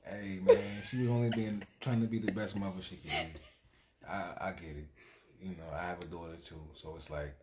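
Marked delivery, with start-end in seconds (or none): 0.0-3.4 loud, amused plea through laughter; laughing defence. Real, not acted. Defending the mother blamed for spoiling her, laughing. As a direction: laugh while sticking up for her.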